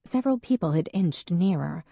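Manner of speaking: ordinary delivery, nothing marked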